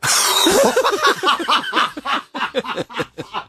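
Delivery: long, high-pitched burst of laughter; real high laughing fit; big laugh. Real, not acted. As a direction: crack up helplessly at the joke.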